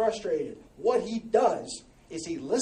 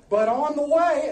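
ordinary talk

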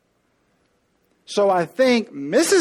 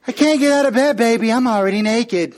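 higher, louder mock-coy teasing; wife teasing her husband from bed, higher and louder; 3 semitones higher, 6 dB louder than usual. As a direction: tease him with a mock-coy excuse. Wife teasing to torture him.

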